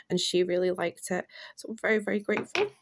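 normal talking voice

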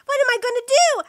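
high, loud dismay; acted panic. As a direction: wail it helplessly. Retake of acted panicked line.

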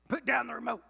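acted marital irritation; irritated command. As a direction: demand it, at the end of your patience. Acts out a spouse fight escalating.

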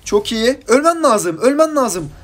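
high, loud cries in the heat of a fight; excited gaming outburst. Real, not acted. Gamer urging the kill after a combo, excited shouting.

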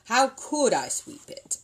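slow, cross, set-apart voice. Acted. Mister Brown snaps at his wife about the chimney, crossly. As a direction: bite out each word crossly. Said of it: "said Mister Brown crossly".

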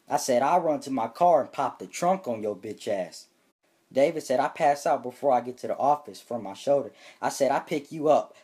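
ordinary talk

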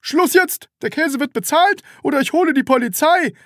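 very high loud threat; threatening. A narrator's reading. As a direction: bark the ultimatum. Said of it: "drohte der Verkäufer".

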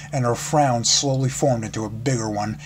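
ordinary delivery

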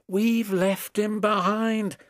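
high, slow mock sob; a storybook wail of loss; sobbing. A narrator's reading. Coaches realizing they left the guard, mild sob. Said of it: "sobbed Annie and Clarabel together".

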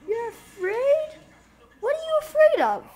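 very high, loud questions; incredulous shouting. Real, not acted. Challenges character fleeing car.

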